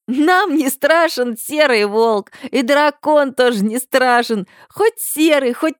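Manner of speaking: high, slow giggling chant; hysterical laughing shout. A narrator's reading. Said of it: "выкрикивает сквозь ... истеричный ржач".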